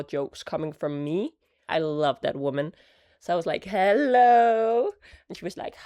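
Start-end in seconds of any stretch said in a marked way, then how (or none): 3.6-4.9 high, loud greeting stretched upward; sing-song phone greeting. Retold or imitated. Greeting mother-in-law on the phone, high sing-song hello. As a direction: draw out the hello and lift it.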